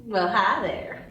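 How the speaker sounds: put-on slow, lightly done Southern belle accent; flirty hint of an Alabama drawl; put-on Southern drawl greeting a man at a bar; fake Southern drawl. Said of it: "Violet resumes the fake Southern drawl".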